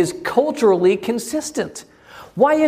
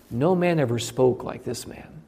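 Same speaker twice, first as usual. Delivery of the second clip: softer, lower, awestruck reply; awed hush; 5 semitones lower, 6 dB quieter than usual. Acted. Guards report back to the leaders in hushed awe. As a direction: say it as if still stunned by what you heard.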